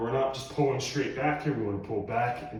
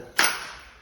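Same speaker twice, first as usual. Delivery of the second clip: tired sigh; 4 dB louder than usual. Real, not acted. End of tiring workout.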